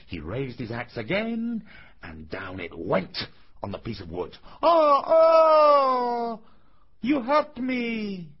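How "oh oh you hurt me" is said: high, loud wail of pain; tiny voice crying out. A narrator's reading. Piece of wood struck by the axe, high pained cry. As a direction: wail in a tiny drawn-out squeal. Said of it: "cried the same little voice".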